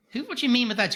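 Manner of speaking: high, baffled retort; mock bewildered. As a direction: pretend to be stunned by the line. Mock confused question to character.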